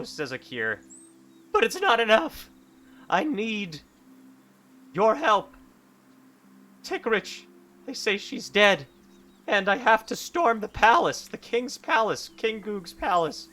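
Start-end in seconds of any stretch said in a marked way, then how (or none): 1.5-13.5 high, loud and urgent pleading; desperate hero. Acted. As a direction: beg your old mentor, rising panic. Desperate hero begs for help.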